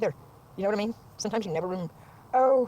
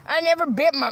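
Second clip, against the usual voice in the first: mocking the accuser; 3 semitones higher than usual. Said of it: "I'll show you what this bitch said".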